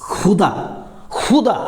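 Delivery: खुदा said as "khudaa" with a throat-scraped kh; careful Urdu-style "xudaa" for खुदा; nukta kh sound. Shown as a demonstration. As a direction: start खुदा with the fricative of "loch". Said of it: "ख में नुक्ता लगा देंगे".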